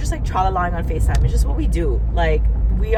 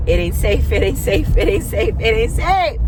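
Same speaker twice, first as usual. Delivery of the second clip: louder repeated warning, rising at the end; emphatic warning; 6 dB louder than usual. Real, not acted. Warning viewers about the stranger, louder repeated chant. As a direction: warn them it's not safe.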